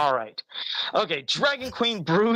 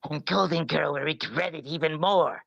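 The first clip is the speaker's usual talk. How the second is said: evil queen voice; 3 semitones lower than usual. Acted. Joe voices Dragon Queen.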